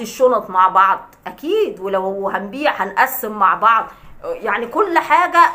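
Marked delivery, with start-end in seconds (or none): none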